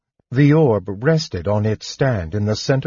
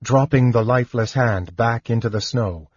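usual speaking voice

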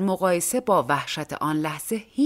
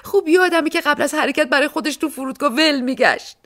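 much higher and louder, shouting through sobs; crying shout; 9 semitones higher, 6 dB louder than usual. A narrator's reading. Woman to police about the fat dog, crying shout. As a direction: wail the complaint while crying.

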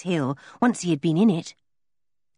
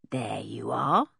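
hushed, softer grinning taunt; sly whisper; 7 semitones lower, 5 dB quieter, slower than usual. Acted. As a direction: breathe it out with a smirk. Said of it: "grinning at the two angry girls".

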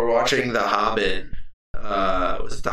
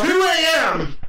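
usual speaking voice

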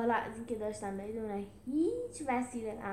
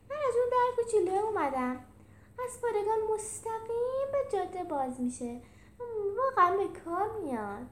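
high-pitched giraffe character, proud of his shortcut; high, eager explaining; cheerful Rufus; 10 semitones higher, 4 dB louder than usual. Acted. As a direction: pipe up brightly in a high voice.

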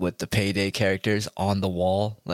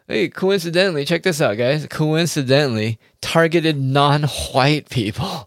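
sarcastic reveal; 5 semitones higher, 4 dB louder than usual. Real, not acted.

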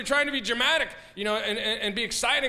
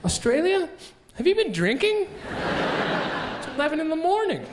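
higher, incredulous telling-off; mock scolding; 6 semitones higher than usual. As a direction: scold Australia like a tipsy friend. Scolding the country like a drunk.

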